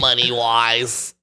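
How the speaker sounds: very slow comic beggar; homeless man voice. Acted. Beggar asking friends for cash, homeless man voice. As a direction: drag the words out as a panhandler. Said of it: "I like your homeless voice".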